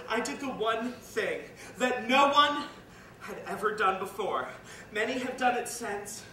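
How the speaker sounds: loud, slow, hammered phrases with pauses; defiant declaration. Acted. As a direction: proclaim the defiance forcefully, phrase by phrase. Defiant climax, refusing Santa.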